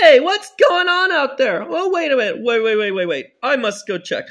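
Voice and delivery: alarmed. Acted. Dad hears noise.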